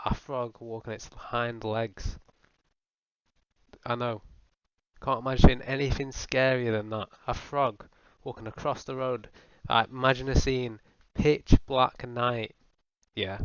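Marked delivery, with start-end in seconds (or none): 0.1-2.2 slow dread. Acted. Slow horrified repeat. 9.7-12.6 hammed-up creepy narration; spooky storyteller. Sets up spooky scene.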